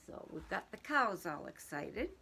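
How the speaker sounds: ordinary delivery, nothing marked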